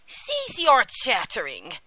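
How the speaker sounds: loud, slow witch's scolding; angry old witch. A narrator's reading. Witch silences the weeping Gretel, angrily. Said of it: "cried the old witch angrily".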